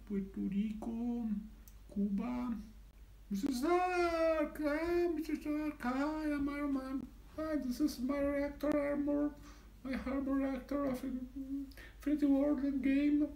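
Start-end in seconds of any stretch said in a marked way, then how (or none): 3.3-13.3 hammed-up Iron Man greeting, higher; Tony Stark. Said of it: "representamos a Tony Stark".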